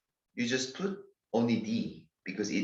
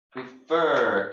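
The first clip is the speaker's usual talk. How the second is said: prefer with a strong, long FER; stress on second syllable; 2 semitones higher, 4 dB louder than usual. Shown as a demonstration. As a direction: make the end of prefer stand out. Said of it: "two syllable verb is stressed".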